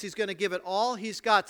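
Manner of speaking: ordinary talk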